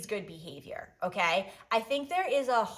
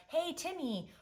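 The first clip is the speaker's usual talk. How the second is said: ordinary talk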